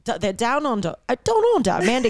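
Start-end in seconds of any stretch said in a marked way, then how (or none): 1.2-1.7 high, loose attempt at an Australian accent. Shown as a demonstration. Host trying out where the sister moved, playful Australian accent. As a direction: pitch it way up in mock Australian. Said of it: "that accent".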